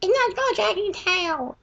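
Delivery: put-on high, slow, huffy little-girl correction; mock pissed-off cousin. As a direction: whine it like an annoyed nine-year-old. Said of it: "it's gonna be all pissed off saying".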